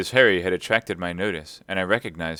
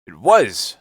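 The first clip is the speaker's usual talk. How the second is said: loud burst of recognition; excited landlord crying out; 2 semitones higher, 10 dB louder than usual. A narrator's reading. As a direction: exclaim it with sudden excitement. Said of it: "cried Silver, now quite excited".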